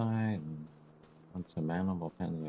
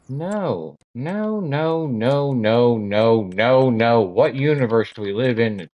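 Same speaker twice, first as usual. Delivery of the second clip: loud, much higher string of nos; outraged rant; 5 semitones higher, 17 dB louder, much slower than usual. Real, not acted. Gamer ranting at the game about late sunrise, shouting. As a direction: shout the protest in mounting outrage.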